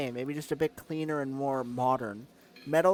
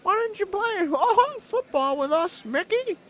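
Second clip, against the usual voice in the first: Mickey Mouse voice; 4 dB louder than usual. Acted. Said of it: "the Mickey Mouse voice".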